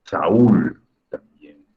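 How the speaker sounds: Saúl slowly, two syllables, Sa-ÚL; stressed Saúl. Shown as a demonstration. Teacher spelling out Saúl slowly, ú stressed. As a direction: stretch Saúl, the ú loud and separate. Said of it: "porque suena más fuerte la vocal débil".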